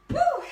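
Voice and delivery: loud, high-pitched workout whoop. Real, not acted. Instructor cheering on jump rope, loud whoop. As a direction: let out a big energetic whoop.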